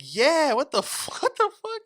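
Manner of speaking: high, loud retold indignation breaking into laughter; incredulous outburst. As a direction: play up the disbelief, voice shooting up. Acts out his indignant reply.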